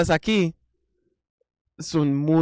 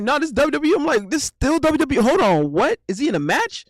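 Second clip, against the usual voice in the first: higher, excited baffled voice; replaying his stunned reaction; retold disbelief; 9 semitones higher, 3 dB louder, faster than usual.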